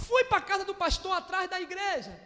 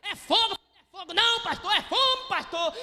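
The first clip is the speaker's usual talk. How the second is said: loud shout of fire and hunger, pitched up; loud mock shout; 5 dB louder than usual. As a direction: yell it over the praying crowd. Shouting over prayer noise.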